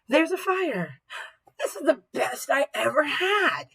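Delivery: hammed-up exclamations for a class demo; strong feeling. Shown as a demonstration. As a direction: burst out as if alarmed, then thrilled. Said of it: "I said them differently".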